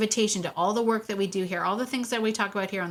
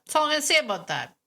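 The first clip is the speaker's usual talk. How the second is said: playful higher repeat; 8 semitones higher, much faster than usual. Shown as a demonstration. Host jokingly closing the topic, higher and playful. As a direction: repeat it up high, teasingly.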